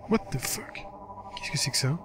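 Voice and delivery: hushed disbelief; baffled. Real, not acted. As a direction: mutter it in bewilderment, voice barely there. Baffled reaction to game text.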